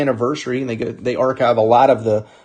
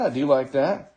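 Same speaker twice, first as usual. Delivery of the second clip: softer second take, said to oneself; quiet repeat; 5 dB quieter than usual. Real, not acted. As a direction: echo it softly, half under your breath.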